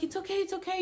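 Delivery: soft, high hushed reassurance; calming. Retold or imitated. Helpers trying to calm them down, soft reassurance. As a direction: soothe quietly, imitating the helpers. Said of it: "they're trying to calm me down".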